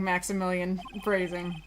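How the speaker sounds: ordinary talk, nothing marked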